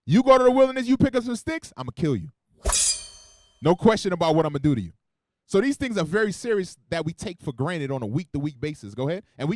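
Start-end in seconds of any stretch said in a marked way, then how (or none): 0.1-4.9 louder, driving sermon delivery; forceful preaching. Acted. Preacher warning the congregation about breaking Sabbath, forceful preaching. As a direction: hammer the warning home from the pulpit.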